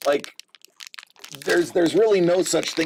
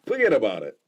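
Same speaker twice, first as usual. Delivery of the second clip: put-on lower, "Forget" punched in a New York Italian accent; Uncle Vito accent; 5 semitones lower, much faster than usual. Quoting his uncle to viewers, New York Italian accent. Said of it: "my Uncle Vito would say".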